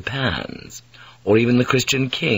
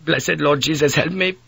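put-on higher, pained Dutch-accented plea; sick Dutch mate; 6 semitones higher than usual. Retching mate crying to God, higher pained Dutch voice. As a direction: beg for help between retches.

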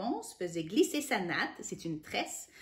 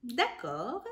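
slow drawn-out scheming hum; sly witch; 3 semitones higher, 4 dB quieter, much slower than usual. Acted. Witch agrees to the husband's plea, slow sly scheming. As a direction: savor the deal with a wicked grin. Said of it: "grimaça un vilain sourire".

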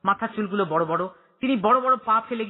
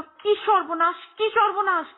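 high lament climbing higher; wailing; 10 semitones higher than usual. A narrator's reading. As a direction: wail it out, beating your head. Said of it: "হাউমাউ করে উঠল".

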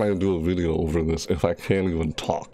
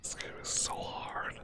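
hushed whisper, barely breathing; 6 dB quieter than usual. Real, not acted. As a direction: whisper so the monster can't hear. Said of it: "I literally have to whisper".

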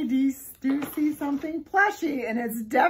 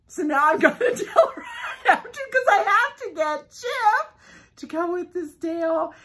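louder, higher, fast and laughing; laughing excitement; 7 semitones higher, 7 dB louder than usual. Real, not acted. Vlogger planning to buy Chip, giddy laughing. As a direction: bubble over with giddy laughter.

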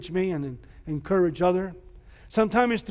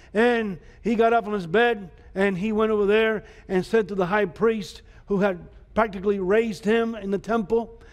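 ordinary talk, nothing marked

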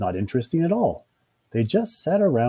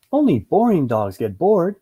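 lightly voiced higher owner voice; lively, higher reproof; chiding owner; 6 semitones higher, 5 dB louder than usual. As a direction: chide the dog, pitched up and lively. Owner chiding her bored dog.

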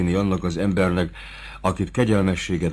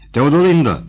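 calling out loud, higher; kiáltás; 7 semitones higher, 6 dB louder than usual. A narrator's reading. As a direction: call her name across the inn. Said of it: "kiáltotta". (hangos kiáltás)

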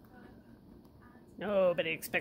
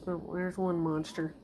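normal talking voice

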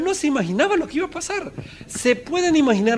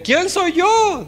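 much higher, slow doubtful question; Moses' doubt; 11 semitones higher, much slower than usual. Retold or imitated. Preacher voices Moses doubting at the burning bush, high and slow. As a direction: plead it, unsure of yourself. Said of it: "dijo, ¿se acuerdan?".

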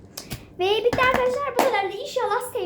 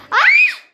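ani acı çığlığı; 12 dB louder than usual. Real, not acted. Sudden pained cry while working.

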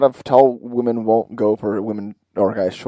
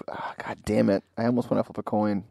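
ordinary talk

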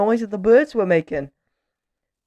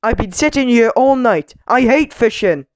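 loud, raised-voice complaint; grumbling; 6 semitones higher, 7 dB louder than usual. A narrator's reading. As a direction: moan indignantly about a miserable night. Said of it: "grumbled Mister Grumble".

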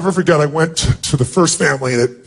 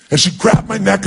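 ordinary delivery

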